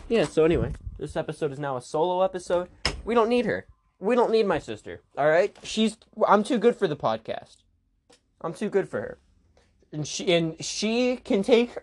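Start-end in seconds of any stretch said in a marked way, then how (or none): none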